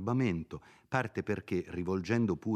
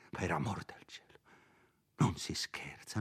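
hushed, fearful warning; frightened whisper; 5 dB quieter than usual. Acted. Priest warns Renzo in a frightened whisper. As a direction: drop to a scared whisper. Priest recalls deadly threat.

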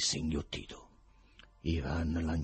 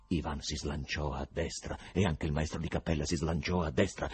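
plain reading voice

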